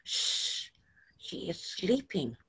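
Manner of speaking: put-on child whispering about sleeping baby; whispered. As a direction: hush it so the baby keeps sleeping. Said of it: "we three whispered".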